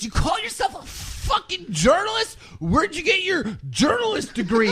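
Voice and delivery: high mock yell breaking into a laugh; mock shrieking troll. Keemstar quoting a troll mocking his journalism. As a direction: screech the taunt, cracking up. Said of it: "And he goes".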